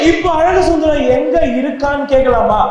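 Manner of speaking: ordinary delivery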